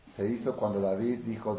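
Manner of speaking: ordinary delivery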